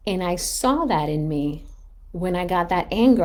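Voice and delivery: usual speaking voice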